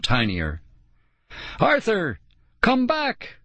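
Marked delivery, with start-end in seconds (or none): 1.6-3.4 high, stretched distant call; calling after someone. A narrator's reading. Kids chase the shrinking sled, calling out. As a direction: cry out high without full volume.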